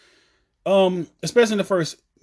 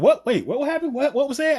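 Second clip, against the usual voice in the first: acted confusion; 7 semitones higher than usual. Acting out her own confused reaction.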